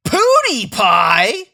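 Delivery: loud slow disbelieving; mock-horrified echo; incredulous. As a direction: repeat it in disbelief. Incredulous repeat.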